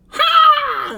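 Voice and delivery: high shrill-free squawk, loud; a bird lands and squawks loudly. Acted. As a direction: cry out like a landing bird. Bird swoops onto the cart.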